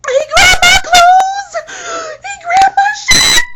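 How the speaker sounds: very high, loud sobbing panic; high pitched crying. Retold or imitated. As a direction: wail it high and tearful, barely getting words out. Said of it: "real high pitched tone and me crying".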